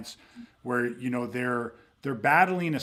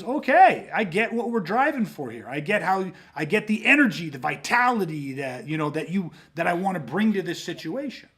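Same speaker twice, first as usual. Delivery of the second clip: louder and higher, suddenly energized and convinced; body fired up; 4 semitones higher, 4 dB louder than usual. Acted. The body answering the coach's image, excitedly. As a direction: burst into it like your legs just switched on.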